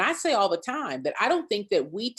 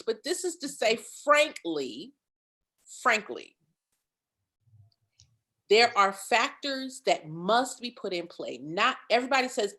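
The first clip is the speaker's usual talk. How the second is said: ordinary talk, nothing marked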